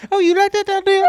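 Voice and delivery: high, loud New Orleans hollering; New Orleans accent. Pretend. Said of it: "This is my New Orleans accent".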